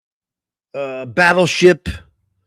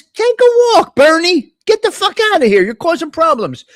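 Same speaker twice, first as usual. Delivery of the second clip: mock yell; 9 semitones higher than usual. Host voicing the governor shooing Bernie away, high mock yell. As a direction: shoo him away with a shrill mock shout. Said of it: "Governor says".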